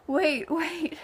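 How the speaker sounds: amused wait wait; laughing disbelief. Retold or imitated. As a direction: laugh out a mock protest. Retold disappointment at movie.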